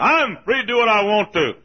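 mimicked defiant claim; defiant objector. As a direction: declare it like a stubborn objector.

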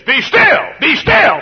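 put-on high, loud shout to settle restless children; stern command. Preacher ordering the kids in church to be still, shouting.

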